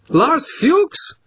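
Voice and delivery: high-pitched disbelieving question; incredulous. A narrator's reading. Operator can't believe whom they want, incredulous. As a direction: echo the name in high disbelief. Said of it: "only half believing his ears".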